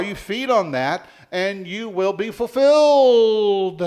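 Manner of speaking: high, loud, slowing to a stretched "fulfilled"; rousing preaching. Real, not acted. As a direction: proclaim the promise, holding the last word long. Preacher at climax of promise.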